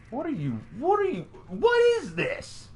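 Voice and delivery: high, squeaky disbelief; baffled outburst. Retold or imitated. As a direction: react as if you can't believe your eyes. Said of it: "And I go".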